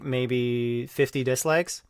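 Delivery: ordinary talk, nothing marked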